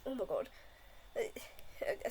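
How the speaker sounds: hoarse and breaking from coughing; talking through a coughing fit. Real, not acted. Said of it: "I'm really coughing right now".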